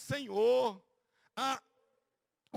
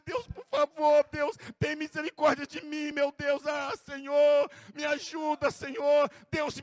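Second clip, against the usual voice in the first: loud crying plea to God, voice breaking; tearful repentant prayer; 2 semitones higher, 5 dB louder, slower than usual. Pretend. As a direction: show the congregation how to weep in repentance. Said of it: "você diz". (oração chorosa de arrependimento)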